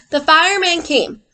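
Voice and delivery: ordinary delivery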